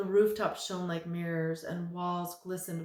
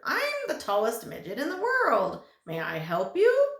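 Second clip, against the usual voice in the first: high, loud, beaming doorman voice; cheery midget voice; 6 semitones higher, 9 dB louder than usual. Acted. Tallest midget greets Milo and Tock, high and beaming. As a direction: greet them like a proud little host.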